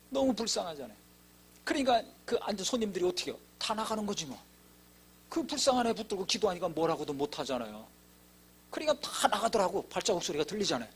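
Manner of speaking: ordinary talk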